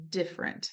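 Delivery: "different" in two syllables, dif-frent; spoken different. Shown as a demonstration. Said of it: "We say".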